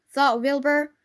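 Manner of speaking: ordinary delivery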